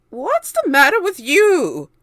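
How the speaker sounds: high, very loud screech. A narrator's reading. Aunt Spiker screeching at James, high and loud. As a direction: screech at the boy like a witch. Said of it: "Aunt Spiker screeched".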